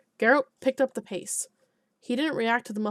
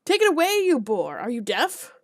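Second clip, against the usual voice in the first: shouting at peasant; 6 dB louder than usual. Acted. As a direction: bark the peasant away, then back down. Alderman yells at the peasant.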